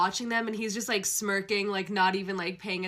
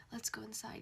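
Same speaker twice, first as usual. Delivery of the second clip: hushed tender whisper; 12 dB quieter than usual. Acted. Male lead coaxing crying heroine, soft whisper. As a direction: breathe it softly to her.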